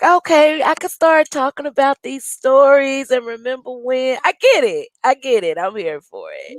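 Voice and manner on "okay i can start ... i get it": excited. Retold or imitated. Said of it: "I'm like".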